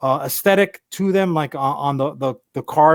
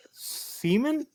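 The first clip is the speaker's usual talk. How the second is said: incredulous; 9 semitones higher, 10 dB quieter than usual. Retold or imitated. Incredulous repeat of the name.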